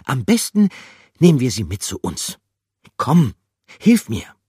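very high, loud bossy call; high, loud, urgent request; urging character. Acted. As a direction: take charge and urge help. Gruyère takes charge.

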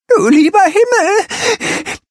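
breathless gasp; high, slow gasping cry. A narrator's reading. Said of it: "japst sie".

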